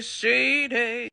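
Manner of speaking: "shady" stretched in a sassy put-on voice; sing-song "shady" with attitude; drawn-out shady. Real, not acted. Vlogger judging RJ's snub with a sassy drawn "shady". Repeated judgment word, drawn out.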